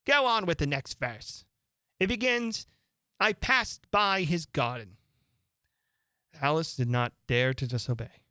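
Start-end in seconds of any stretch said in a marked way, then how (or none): 0.0-5.0 much higher, brisk beast voice; griffin voice. Acted. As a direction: cut in like an impatient beast.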